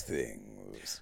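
low announcer intoning the title; deep title voice. Acted. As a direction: drop the voice right down for the title. Deep spoken show title.

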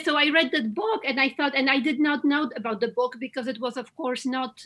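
usual speaking voice

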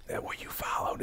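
hushed, almost voiceless movie quote; imitated dropped accent. Podcaster quoting Yvette's line after the accent drops, imitated in a hush. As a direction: mimic her line in a near-whisper. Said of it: "she drops her accent and she's like".

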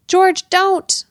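high, loud cry after him; desperate shout. Acted. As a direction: call out to stop him. Margot calls after fleeing George.

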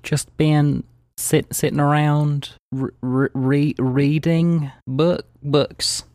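halting, nervous stutter on first sounds. Acted. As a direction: stumble and restart, dragging the words out. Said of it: "She has a stutter".

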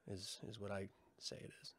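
sly whispered aside. Real, not acted. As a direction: drop to a breathy whisper for the punchline.

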